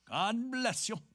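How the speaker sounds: bright high cheery greeting. A narrator's reading. Martin Cunningham thanks the policeman, cheerily. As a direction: greet him with real cheer. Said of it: "said cheerily".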